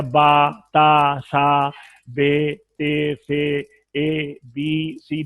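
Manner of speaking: बा ता सा each said slowly and evenly; slow letter drill; slow letter names. Shown as a demonstration. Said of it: "हरूफ हिजा की जितनी तभी मिकदार".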